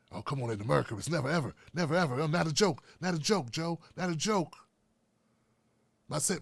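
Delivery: low, quiet, mumbling elder statesman; mock the old senator's faltering plea to the nation; mumbled old politician. Mumbling old politician pleading to America.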